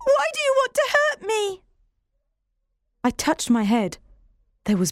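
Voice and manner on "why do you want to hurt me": put-on much higher, pleading girl's voice; desperate child's question; tearful cry. Hurt Jane pleads with John, tearful high voice. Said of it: "I shouted".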